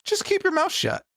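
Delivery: high-pitched mock scold. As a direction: snap at him in a put-on voice. Scolding Chet Hanks, mock.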